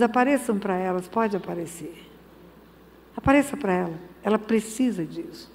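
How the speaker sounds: low, soft, gentle order; tender command. Retold or imitated. God quietly telling the angels to appear, low and soft. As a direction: speak it like a father's quiet kindness.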